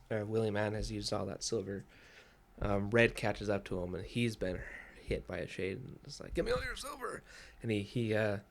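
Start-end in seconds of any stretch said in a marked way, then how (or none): 6.3-7.2 very high, strained mock cry; desperate demand. Wounded Red demanding silver from the women, high desperate voice. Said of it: "he's desperate for silver".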